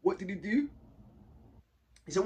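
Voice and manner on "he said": usual speaking voice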